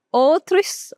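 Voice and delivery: ordinary talk, nothing marked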